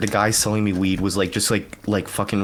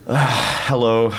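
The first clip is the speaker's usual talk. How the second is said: mock put-upon sigh into a louder hello; dismissive attitude; 3 semitones higher, 3 dB louder, slower than usual. French speaker greeting a non-native with attitude, mock disdain. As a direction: imitate someone grudgingly greeting with attitude. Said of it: "they give you, like, an attitude".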